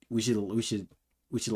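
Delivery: ordinary delivery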